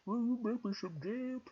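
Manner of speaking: high, put-on storybook moose; cartoonish moose asking sweetly; moose character voice. A narrator's reading. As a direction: plead in a squeaky-high animal voice.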